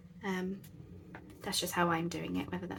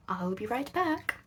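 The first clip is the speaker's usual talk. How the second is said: lilting sing-song sign-off; 3 semitones higher than usual. Real, not acted. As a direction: sing it out lightly. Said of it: "like, a sing-song voice then".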